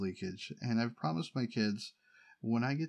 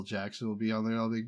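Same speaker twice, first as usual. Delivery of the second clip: ordinary talk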